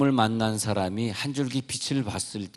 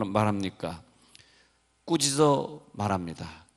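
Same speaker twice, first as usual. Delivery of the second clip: usual speaking voice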